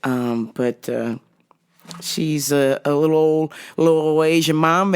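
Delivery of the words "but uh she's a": normal talking voice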